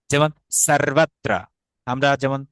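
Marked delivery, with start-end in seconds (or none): 0.7-1.3 slow 'sarvatra' for সর্বত্র, with a true 's'; Sanskrit dental s. Shown as a demonstration. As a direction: open 'a' and rolled r in সর্বত্র. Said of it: "স এর মতো হয়ে যায়". (সংস্কৃত উচ্চারণে সর্বত্র)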